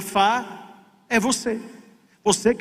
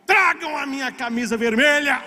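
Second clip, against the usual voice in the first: high-pitched rallying order; retold captain's shout; 6 semitones higher than usual. Captain at the bow orders his red shirt brought, shouting. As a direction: call it out like a battle command. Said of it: "ia pra frente do navio e gritava".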